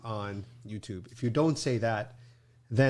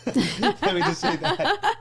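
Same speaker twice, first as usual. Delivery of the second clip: laughing through the words, high; 6 dB louder, much slower than usual. Real, not acted. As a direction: giggle it out as an aside. Laughing aside about demonetizing.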